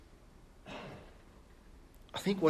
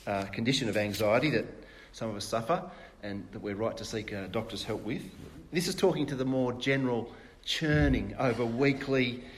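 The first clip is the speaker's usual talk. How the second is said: ordinary talk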